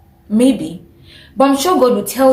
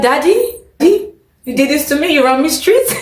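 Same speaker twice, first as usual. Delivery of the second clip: higher-pitched, disbelieving imitation; mock shock; 6 semitones higher than usual. Imagining the shocked husband to the pastor, mock disbelief. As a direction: voice his shocked question, on the edge of laughter. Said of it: "he was shocked".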